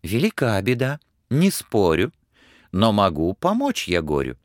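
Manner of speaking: a clever friend giving a plan; wise little horse. Acted. Humpback horse advises Ivan what to ask the tsar, calm.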